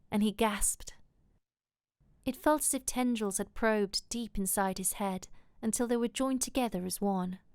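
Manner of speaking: ordinary delivery, nothing marked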